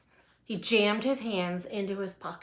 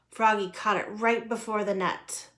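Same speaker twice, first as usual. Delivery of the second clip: ordinary delivery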